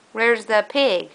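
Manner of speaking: usual speaking voice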